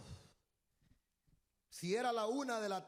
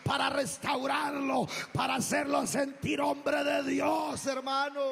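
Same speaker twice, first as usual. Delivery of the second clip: preaching at full force; 3 semitones higher, 6 dB louder than usual. Acted. Pastor preaching Moses kneeling before God, full force. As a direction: drive the sermon home with rising fervor.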